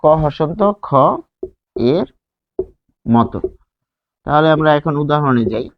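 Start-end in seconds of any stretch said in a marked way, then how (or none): none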